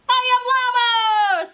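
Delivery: high, loud, drawn-out holler as a llama; Llama character voice. A narrator's reading. Llama introduces himself to Alpaca, high loud character voice. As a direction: belt it out slowly, way up high. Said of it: "said Llama".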